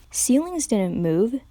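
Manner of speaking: plain reading voice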